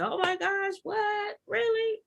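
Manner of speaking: high, squeaky disbelief; mock shocked gasp. Pastor faking surprise to Paige, high shocked squeak. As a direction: play dumb with big shock.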